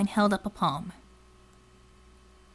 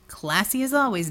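ordinary delivery, nothing marked

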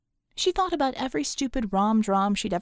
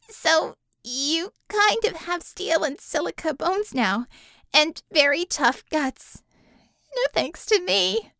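higher, louder, slowed guilty explanation; guilty young woman; 5 semitones higher, 4 dB louder than usual. Acted. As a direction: blame yourself as you explain. Bella explains, guilt-ridden.

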